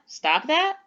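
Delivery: "Stop that" as a question, rising high on "that"; asking. Shown as a demonstration. Teacher testing pupils on punctuation, asking "Stop that" with a rise on "that". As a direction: lift "that" sharply upward to ask. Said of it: "Am I asking them to stop that?".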